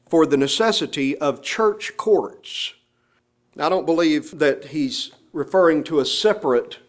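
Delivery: ordinary talk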